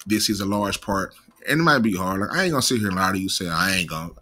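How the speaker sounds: ordinary talk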